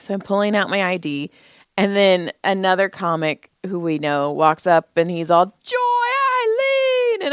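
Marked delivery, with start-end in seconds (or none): 5.5-7.3 very high, long drawn-out name call; mock squeal of greeting, stretched right out; excited greeting. Comic walks up on Joy at the door, squealed greeting.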